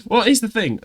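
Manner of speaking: laughing high burst of excitement; bursting to tell the news; excited. Real, not acted.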